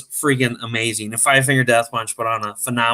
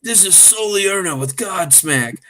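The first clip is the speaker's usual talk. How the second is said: put-on rock star on the phone, pitched up; raised, official-sounding celebrity caller voice; 5 semitones higher than usual. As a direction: imitate a singer announcing himself, higher voice. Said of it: "you pretend you're [name]".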